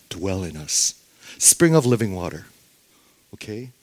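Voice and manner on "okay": ordinary talk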